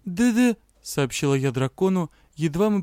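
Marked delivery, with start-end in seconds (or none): 0.0-0.6 chattering from cold; shivering teeth chatter. Acted. Heroine frozen after flight.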